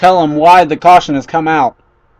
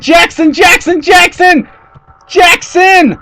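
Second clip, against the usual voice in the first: shouting a name; 10 semitones higher, slower than usual. Real, not acted. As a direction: call the name out in alarm. Crash unfolding in race call.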